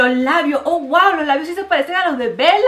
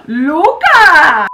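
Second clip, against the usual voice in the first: gasp and cry; 9 semitones higher, 7 dB louder than usual. Real, not acted. Rocker Luca reveal.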